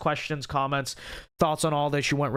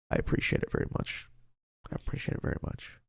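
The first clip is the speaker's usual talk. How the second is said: soft, quick, whispered thanks; real voice loss, thanks mostly on breath; voice giving out; 6 dB quieter, faster than usual. Real, not acted. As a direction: hurry out quiet thanks with almost no voice. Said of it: "My voice is not gonna make it".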